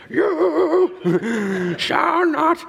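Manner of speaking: very drawn-out, high mock wizard bellow; hammed-up wizard cry for a laughing audience; Ian McKellen as Gandalf. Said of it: "in the Ian McKellen accent".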